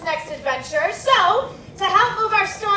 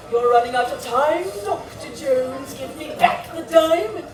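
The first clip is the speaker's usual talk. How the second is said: menacing villain; 4 semitones lower than usual. Acted. Villain voice demands diamond.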